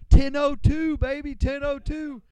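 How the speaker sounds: loud, high holler; rowdy full-voiced yell. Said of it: "I yell out my number".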